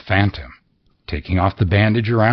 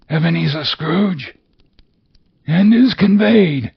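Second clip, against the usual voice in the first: high, wailing otherworldly voice; mournful ghost; 7 semitones higher than usual. Acted. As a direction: lament like a doomed spirit.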